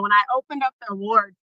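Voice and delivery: ordinary talk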